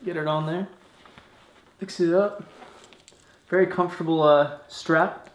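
muffled behind chin bar; talking inside a helmet. Real, not acted. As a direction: voice enclosed by helmet. Seen 0: Full-face helmet pulled on at 0.2 s, covering mouth throughout.

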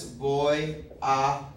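"Voy a clase" said very slowly, each word set apart; slow Spanish example. Shown as a demonstration. Teacher modelling a Spanish sentence for students, very slow. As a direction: model the phrase carefully for learners. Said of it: "for instance".

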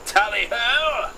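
high, loud, long-drawn colonel cheer; rousing drawn-out holler; hearty cry. Acted. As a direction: call it out like a charge. Colonel cheers as bus departs.

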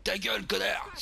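high, sharp shut-up; angry retort. Pretend. Snaps at shopkeeper.

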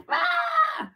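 a big high burst of hype; mock rah shout. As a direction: blast out a mock hype yell. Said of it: "everyone has got to be like".

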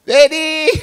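put-on very high, loud child's call; piercingly high mock child cry; child calling father. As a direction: imitate a little son calling out for dad. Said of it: "oía a mi hijo que me hablaba".

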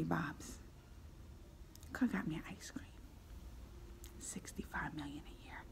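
soft, unvoiced mock sulk; whispered grumble. Real, not acted. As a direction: mutter a petty wish under your breath.

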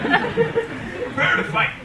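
put-on very high, slow laughing baby voice; high giggly baby game voice. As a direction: squeak it out like a toddler. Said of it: "like how a little baby".

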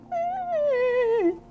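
very high fake baby wail; pretend crying. Doll starts crying again, high mock wail. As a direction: imitate a baby starting to bawl. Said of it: "start crying again".

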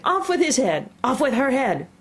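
high, raging commands; furious shouting. A narrator's reading. Queen stamps about in a passion, shouting high. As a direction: shout each order high and imperious.

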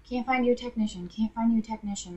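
mock repetition. Retelling the agent's failed search, quiet mocking echo. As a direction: parrot the agent dismissively.

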